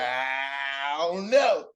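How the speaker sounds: drawn-out, doubtful hedging; skeptical. Acted. Believer doubting a blessing that came through, skeptical. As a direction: stretch it out slowly, full of doubt. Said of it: "kinda skeptical when".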